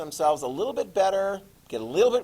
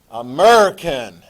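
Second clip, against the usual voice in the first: American accent; 10 dB louder than usual. Shown as a demonstration. Teacher naming what we speak here, exaggerated American accent. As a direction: lean hard on an American accent. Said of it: "they speak American".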